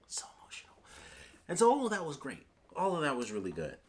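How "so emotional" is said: breathless whisper, choked up with emotion; overwhelmed whisper. Real, not acted. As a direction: whisper it as if too moved to speak aloud. Overwhelmed reaction to the scene.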